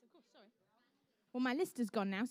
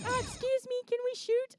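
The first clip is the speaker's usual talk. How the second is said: higher, mock-polite asking; polite student; 10 semitones higher, 4 dB louder than usual. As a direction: imitate a very polite kid asking permission. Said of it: "That was so polite".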